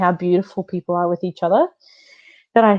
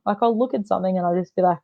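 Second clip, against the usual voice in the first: ordinary delivery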